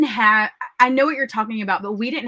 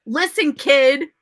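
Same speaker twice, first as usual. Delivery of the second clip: annoyed DJ; 5 semitones higher, 5 dB louder, slower than usual. Retold or imitated. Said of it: "the D J was like".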